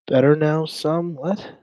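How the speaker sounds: high, puzzled echo of the chat's words; baffled echo. Real, not acted. Streamer echoing a strange chat message, baffled. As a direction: repeat it as a confused question. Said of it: "What does that even mean".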